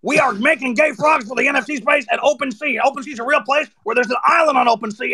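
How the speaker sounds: an over-the-top Alex Jones rant. Pretend. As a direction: rant like an InfoWars host. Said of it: "see what that one's all about".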